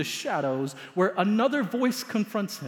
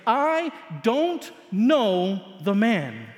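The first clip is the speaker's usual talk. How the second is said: very slow, louder, each word dragged out; a last slow forceful denial; repeated denial; 3 semitones higher, 4 dB louder, much slower than usual. Acted. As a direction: drag the oath out heavily. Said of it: "this repeated phrase".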